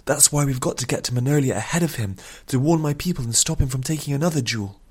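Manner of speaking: high, urgent insistence; agitated. A narrator's reading. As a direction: press them to go, agitated. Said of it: "still agitated too".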